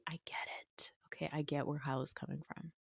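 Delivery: conspiratorial whisper; hushed confiding whisper. Real, not acted. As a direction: whisper it like a secret aside. Aside after gossip.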